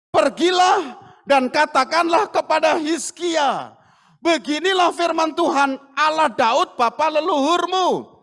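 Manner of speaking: loud, high declaration; proclaiming God's word. Acted. Preacher voicing God speaking to Yesaya, loud and grand. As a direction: proclaim it like a herald.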